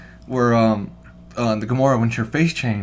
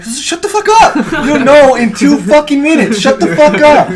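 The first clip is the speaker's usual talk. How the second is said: high, very loud outburst; full-volume retold rant; exasperated shout; 16 dB louder than usual. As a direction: yell at a talker in the cinema. Retells snapping at talkative moviegoer.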